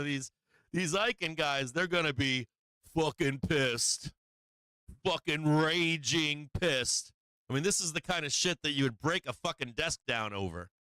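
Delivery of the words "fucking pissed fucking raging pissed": slow, weighty stress on every word; slow emphasis. Real, not acted. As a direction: drag out each word, slow and heavy. Hammering home traders' fury.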